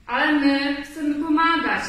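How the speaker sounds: acted insistence from a keen helper; insistent. A narrator's reading. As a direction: press the point eagerly. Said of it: "nalegał tygrys".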